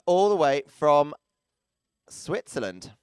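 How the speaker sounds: ordinary talk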